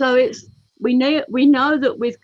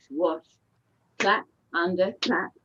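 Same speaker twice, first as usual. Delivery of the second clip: ordinary talk, nothing marked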